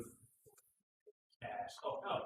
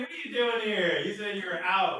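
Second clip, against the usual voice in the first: high, loud showman calling out; a loud, high carnival barker energy; loud excited hype; 7 semitones higher, 8 dB louder than usual. Real, not acted. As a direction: hype up the magic tricks. Hyping the camera, laughing.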